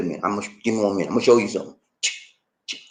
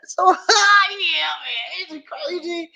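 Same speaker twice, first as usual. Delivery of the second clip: high, slow, laughing squeal; host can't stop laughing at the story; helpless laughter; 11 semitones higher, much slower than usual. Real, not acted.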